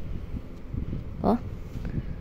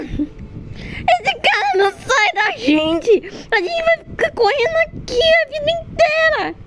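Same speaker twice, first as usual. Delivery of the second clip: being chased; 8 semitones higher than usual. Real, not acted. Chased, despairing.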